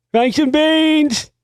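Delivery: high, slow, drawn-out character voice; comic high-pitched movie quote; movie catchphrase impression. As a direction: stretch it out way up high.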